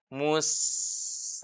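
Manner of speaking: "mus" with a long sustained hiss; desis keluar. Shown as a demonstration. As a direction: stretch the s of "mus" on flowing breath. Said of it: "ada desisnya". ("mus" dengan desis s memanjang)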